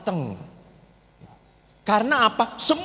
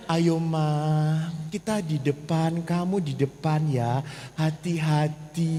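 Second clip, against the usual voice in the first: softer, gentle and caring; gentle invitation; 3 semitones lower, 6 dB quieter, slower than usual. Retold or imitated. How the husband should gently ask his wife to sit in front. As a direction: invite her kindly, slow at the end. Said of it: "Harusnya kan".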